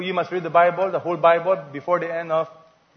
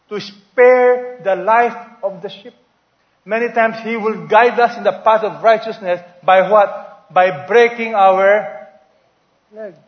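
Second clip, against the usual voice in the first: preaching emphasis; 2 semitones higher, 7 dB louder, slower than usual. Real, not acted. Preacher drives home point.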